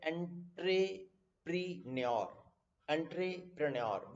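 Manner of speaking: एंट्रेप्रेन्योर as EN-tray-pre-NYOR; spelling pronunciation. Shown as a demonstration. Teacher showing how it may be read, एंट्रेप्रेन्योर as EN-tray-pre-NYOR. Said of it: "इसको ध्यान से देखो तो ऐसे पढ़ सकते हैं".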